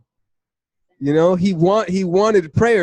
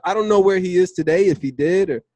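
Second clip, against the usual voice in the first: ordinary delivery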